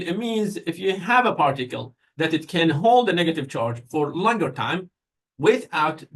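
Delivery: ordinary talk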